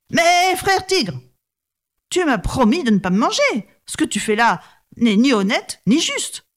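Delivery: reproachful brahman. Acted.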